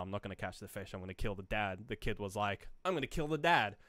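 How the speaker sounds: ordinary delivery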